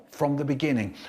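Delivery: usual speaking voice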